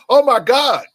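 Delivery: high, loud shocked gasp of "Oh my God!"; shocked outsider. Retold or imitated. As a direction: imitate a neighbour alarmed by a strict father. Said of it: "that person would say".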